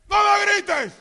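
high, loud wife's protest; shrieked mock complaint; acted protesting shout. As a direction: yell back as the offended wife. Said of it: "parece que estamos gritando".

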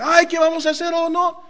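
high, loud mock panic; hammed-up desperate fireman; panicked fireman. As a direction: play the flustered fireman losing it. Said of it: "Imagínense un bombero".

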